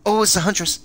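sudden high, loud alarm; startled shout; alarmed cry. Real, not acted. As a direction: yelp at spotting her. Spotting the killer in game.

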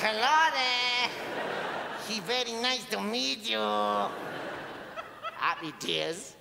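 Sean Gonzalez. Acted. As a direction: greet haltingly in the odd mixed voice. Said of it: "Sean Gonzalez".

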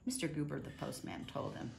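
usual speaking voice

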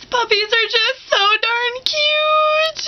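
high, slow, tearful gush; mock crying. Gushing about puppies, hammed-up tearful voice. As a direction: sob over how cute puppies are. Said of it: "Or I'm talking about".

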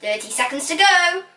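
high presenter's time call. A narrator's reading. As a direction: call it out to the room. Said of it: "called out Truffle".

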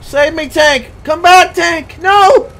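high, loud cries for rescue; desperate shouting. Real, not acted. Gamer begging the tank to come back, desperate shout.

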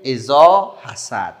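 loud, slow, drawn-out recitation; measured, projected Quran teaching voice; recitation model. Shown as a demonstration. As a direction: call out the verse slowly for pupils to repeat. Models verse for pupils to repeat.

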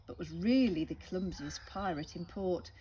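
usual speaking voice

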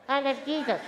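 high mocking "I love Jesus"; phony devotion. Preacher mocking bumper-sticker believers, high and laughing.

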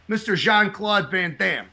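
thick Chicago accent, drawn out. Shown as a demonstration. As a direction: say it like a Chicago guy. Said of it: "how I say that in my thick Chicago accent".

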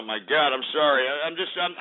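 usual speaking voice

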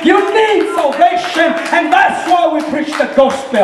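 rising, fervent sermon voice; impassioned preaching. Real, not acted. Preacher testifying about the cross, fervent and building. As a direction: build with urgent conviction to a peak.

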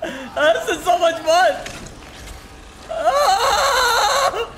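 high, squealing laughter through words; giddy laughter. Real, not acted. Player having so much fun, giddy high laughing.

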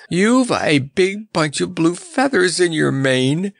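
high, astonished clown; gasping amusement. A narrator's reading. Clown telling the lion about his mane, amazed. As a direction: blurt it out in a high voice. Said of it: "gasped the clown".

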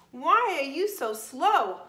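loud high call of a cheeky monkey; hollering monkey. Acted. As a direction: holler it up at the sloth. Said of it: "hollered the monkey".

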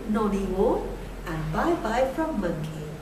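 plain reading voice

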